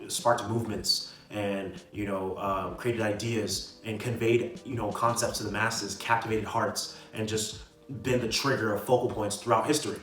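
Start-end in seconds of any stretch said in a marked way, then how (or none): none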